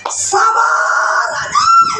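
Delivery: high, whiny mock complaint; mock complaining congregant. Congregant mocking the pastor's advice, high whiny voice. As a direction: lampoon a congregant groaning about being told to be patient.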